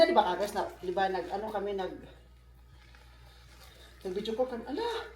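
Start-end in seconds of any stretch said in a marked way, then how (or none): 4.7-5.1 surprised exclamation. Real, not acted. Finds a paper unexpectedly.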